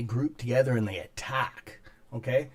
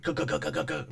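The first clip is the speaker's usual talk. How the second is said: rhythmic imitated hammer drill; "gug, gug" like a drill in concrete; hammer drill noise; 3 semitones higher than usual. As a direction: percussive mouth drill sound. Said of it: "like hammer drill".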